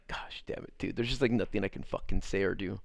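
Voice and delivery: whispered, hushed exasperation; muttered frustration. Retold or imitated. Helpless after her breakup threat, whispered curse. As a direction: curse under your breath in defeat.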